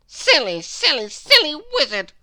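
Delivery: high, loud groaning complaint. A narrator's reading. Mister Mischief picking himself up, groaning. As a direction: groan it after falling over. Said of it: "groaned Mister Mischief".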